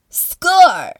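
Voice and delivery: high, loud cheer; triumphant yell. A narrator's reading. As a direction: yell it out like a winning shout. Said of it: "yelled one rider".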